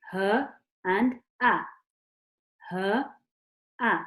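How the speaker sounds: "her" breathed, "a" a sharp glottal stop, clear; letter sounds. Shown as a demonstration. A teacher gives the sounds of ha and hamza, slow. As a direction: breathe "her", then snap a clean glottal "a". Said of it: "And then with the sound".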